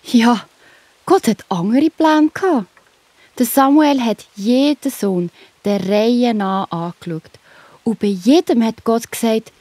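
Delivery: usual speaking voice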